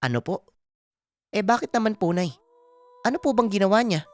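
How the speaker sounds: respectful son questioning his mother; Aming. Acted. (binatang anak na magalang na nagtatanong, mataas ang tono)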